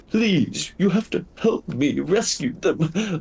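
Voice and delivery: high, earnest begging creature voice; a frightened wolf begging for rescue; the wolf begs strangers to save the kids, high and pleading. Acted.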